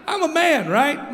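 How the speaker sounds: ordinary talk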